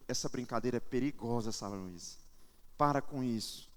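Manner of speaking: low, quiet fatherly warning; stern father. Retold or imitated. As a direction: reenact a dad firmly cautioning his child, voice dropped low. Pai repreende a filha. (pai sério, voz baixa)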